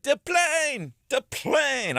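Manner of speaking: high, excited squeaky call; Tattoo voice. Retold or imitated. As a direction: yell it like spotting a plane. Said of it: "Tattoo from Fantasy Island".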